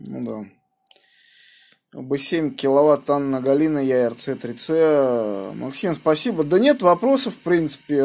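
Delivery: ordinary delivery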